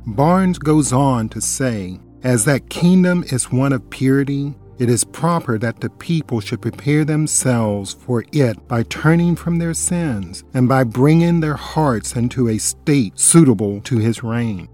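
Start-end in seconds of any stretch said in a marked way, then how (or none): none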